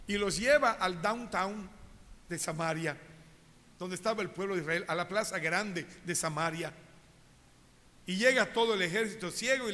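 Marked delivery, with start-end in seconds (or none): none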